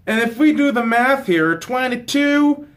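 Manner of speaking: half-unconscious Southern US twang while doing the math; southern accent. Acted. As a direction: count it out in a loose Southern US accent. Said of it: "I don't know why I'm doing a southern shit".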